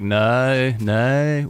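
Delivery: badly faked Australian "no", vowel drawn out; attempted Australian accent. Shown as a demonstration. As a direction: try an Aussie "no" with a gliding vowel. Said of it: "do it in an Australian accent".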